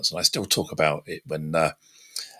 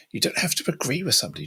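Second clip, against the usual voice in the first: usual speaking voice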